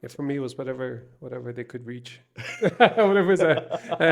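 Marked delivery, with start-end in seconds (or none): 2.3-4.0 louder, higher, laughing mid-word. Real, not acted. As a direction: giggle while you reach for the words. Laughing over childhood punishments.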